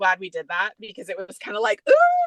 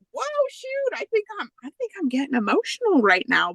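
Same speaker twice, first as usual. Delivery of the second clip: surprised; 11 semitones higher than usual. Retold or imitated. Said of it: "And then I'm like".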